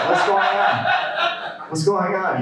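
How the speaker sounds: put-on high, eager kid voices; excited kids. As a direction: ask it like thrilled, slightly nervous children. Kids react to grandpa's surprise.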